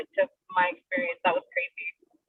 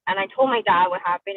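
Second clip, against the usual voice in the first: ordinary talk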